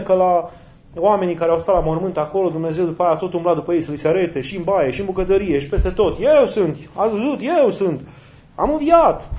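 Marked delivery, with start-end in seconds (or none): 6.2-9.3 mock insistent call. Mock God chasing people.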